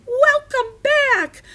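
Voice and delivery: very high, slow squeaky shout. A narrator's reading. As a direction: shout it high and loud, drawn out.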